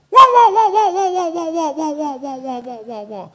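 a peacock flapping off in alarm, trailing away; startled peacock call. Retold or imitated. Teller shows a scared peacock flying off, squawks fading. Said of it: "They make a sound like this".

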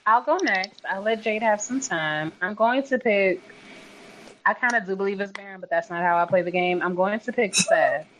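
ordinary talk, nothing marked

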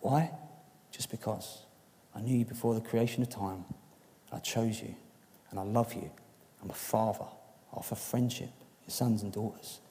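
softly voicing God's tender words. Preacher softly voicing God's words of love to the congregation. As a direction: speak it softly, like a father to his child. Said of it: "I felt him saying".

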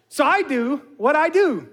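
ordinary talk

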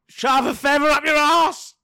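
high, loud blurted line; loud drunken outburst; drunk shout. Acted. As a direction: bellow it like a drunk barfly. Drunk barfly blurts punchline.

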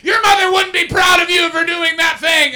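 high, loud barking drill sergeant; drill instructor. Pretend. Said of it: "he's like".

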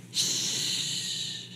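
soft unvoiced shush; a long gentle shh; hushing shh. Shown as a demonstration. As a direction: hush the room like quieting a child. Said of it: "we need to get to that place where we shh".

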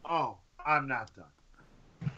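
ordinary delivery

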